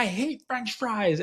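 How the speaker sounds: high, drawn-out mock kid protest; imitated fussy child; Leo. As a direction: mimic a child refusing, slow and high. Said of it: "he just gaslights me all the time".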